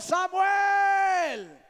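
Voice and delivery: high, drawn-out shout of a name; loud call. Acted. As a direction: call the sleeping boy from far away. Calling out the boy in the story.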